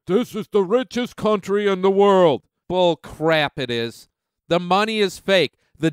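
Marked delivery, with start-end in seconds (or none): 0.1-2.4 quoting Bernie. Retold or imitated. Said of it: "I keep hearing Bernie Sanders say".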